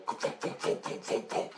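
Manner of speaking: soft, voiceless thudding chops; quiet mouth-made chopper noise; imitated helicopter rotors. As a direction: puff out a steady rotor beat.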